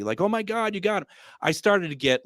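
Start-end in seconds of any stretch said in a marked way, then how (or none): 0.2-1.1 high, excited squeal of disbelief; excited chat room. Retold or imitated. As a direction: mimic a thrilled crowd of viewers. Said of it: "the chat room goes crazy".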